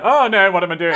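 high, loud mock panic. Deleting a message to Daniel Levy, mock panic. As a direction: yelp it like you just caught yourself.

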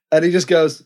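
ordinary delivery

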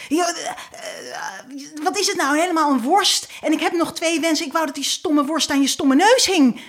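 high, heated shouting back; angry outburst. Acted. Husband shouts back at his nagging wife, high angry outburst. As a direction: snap back at her in a temper. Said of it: "hij is gewoon boos".

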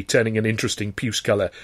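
ordinary delivery, nothing marked

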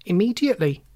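high, firm one-word order; insistent. A narrator's reading. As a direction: insist on it sharply, like the nurse. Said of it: "insisted the nurse".

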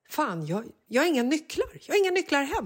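sudden panic, voice jumping up high; panicked. Retold or imitated. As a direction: realize in horror the keys are gone. Panicked realization about missing keys. (panikslagen, rösten hoppar upp högt)

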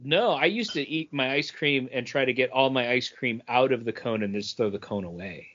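usual speaking voice

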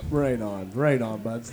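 soft, low Canadian-style aside; muted catchphrase. Acted. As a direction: drop it low and quiet.

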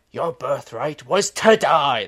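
slow, booming retold movie scream; theatrical mock scream at a son; Anthony Hopkins screaming. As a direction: build to a loud, drawn-out imitation yell. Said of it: "Anthony Hopkins just screams".

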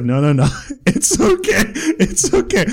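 high, slow, laughing "it's okay"; laughing reassurance. Retold or imitated. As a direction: soothe her through a laugh. Reassuring embarrassed shop girl.

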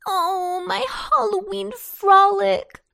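put-on high little-girl lament; mournful wail. A narrator's reading. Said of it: "she mourned".

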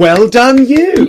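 high, loud patronising praise; mock congratulation. Guest mocking what people say to the diagnosed, sarcastic praise. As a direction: pat them on the head with it. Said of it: "what are you supposed to say".

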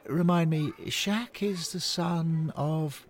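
put-on high, slow questioning old man; lightly voiced old fan asking; Charlie's voice. Charlie asking Bill to remind him, high and slow.

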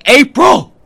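calling a lost daughter across a loud pub; desperate shout. Acted. Running through hospital corridor.

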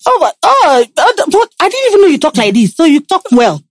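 high-pitched imitation of amazed fans; mock surprise. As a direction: act taken aback, pitched well up. Acts surprised fans hearing her speak.

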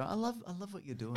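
high-pitched mock praise; patronising. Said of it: "you patronise them and go".

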